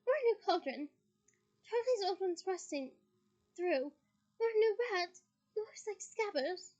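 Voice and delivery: high, loud Mrs Weasley voice; mum voice. Shown as a demonstration. Reader correcting to Mrs Weasley's voice. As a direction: switch to the gushing mother. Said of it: "Oh, wrong voice".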